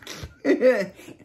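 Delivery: short high chuckle. Real, not acted. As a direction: laugh at your own tale. Laughs at own story.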